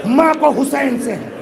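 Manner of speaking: high, sustained majlis lament; mourning lament. Real, not acted. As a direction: preach the tragedy in a high, grief-laden wail. Climax of mourning recitation. (نوحہ خوانی جیسا بلند، دردناک بیان)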